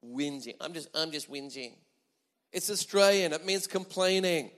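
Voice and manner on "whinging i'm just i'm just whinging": softer, lower whinge; demonstrating a whinge, quietly; whiny voice. Shown as a demonstration. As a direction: moan the words like a complainer. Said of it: "Doesn't it sound terrible?".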